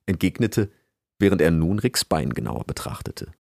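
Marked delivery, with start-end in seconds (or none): none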